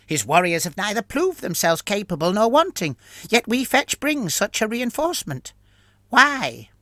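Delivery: a high, wary rat-like creature; ratman voice. Acted. Fricnar's voice in the story.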